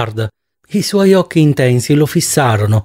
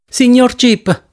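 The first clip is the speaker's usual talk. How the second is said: urgent call, pitched up high; a raised, anxious appeal; agitated; 8 semitones higher, slower than usual. A narrator's reading. Eddie Dorn anxiously interrupts to get Joe's attention, higher urgent call. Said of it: "intervenne agitata Eddie Dorn".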